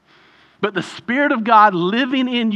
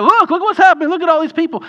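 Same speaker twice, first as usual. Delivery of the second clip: mock-excited, much higher and louder; mock excitement; 11 semitones higher, 6 dB louder, faster than usual. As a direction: ham up an eager church counting converts. Mocking excited church growth.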